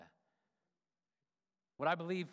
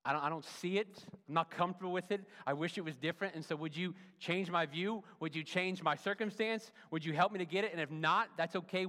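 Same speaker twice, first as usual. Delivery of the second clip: higher and louder, urgent honest plea; pleading prayer; 4 semitones higher, 5 dB louder than usual. Pretend. Modelling a struggling believer's prayer, urgent plea. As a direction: pour the struggle out to God.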